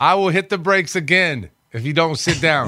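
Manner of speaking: put-on high, angry warning to the kids; angry bus driver. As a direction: threaten the rowdy kids.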